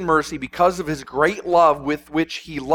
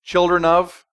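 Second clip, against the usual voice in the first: ordinary talk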